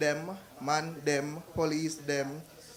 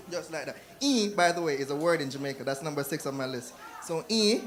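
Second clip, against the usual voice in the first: ordinary delivery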